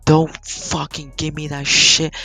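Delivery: worked-up retelling of an angry outburst; going off. Retold or imitated. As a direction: re-enact losing your temper at someone mocking you. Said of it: "I was just going off".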